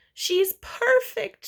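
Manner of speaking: high, slow, adoring mother voice; doting mother. Acted. Mother cooing over her newborn, high and adoring. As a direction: coo it like a proud new mom.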